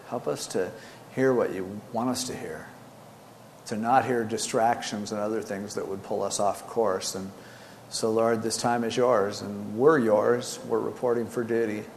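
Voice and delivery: soft, hushed prayer voice. Acted. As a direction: pray quietly and humbly. Opening prayer before the sermon.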